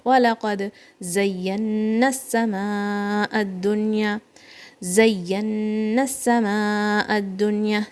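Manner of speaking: fast melodic tajweed recitation; Quran recitation. Shown as a demonstration. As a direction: chant the verse for pupils.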